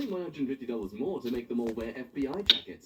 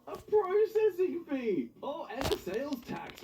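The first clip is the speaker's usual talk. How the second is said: mock disbelief; 13 semitones higher than usual. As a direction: drag it out in mock shock. Mock outrage reading bill.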